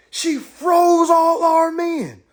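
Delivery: high, slow, drawn-out warning; slow stunned report of the monster; awed Roman witness. Retold or imitated. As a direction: spell it out like a shaken survivor. Retelling Romans warning folks.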